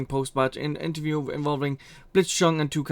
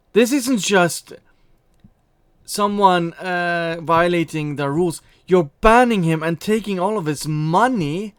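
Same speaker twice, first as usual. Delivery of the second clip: loud, high outraged rant; indignant; 3 semitones higher, 7 dB louder than usual. Real, not acted. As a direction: call them out in outrage.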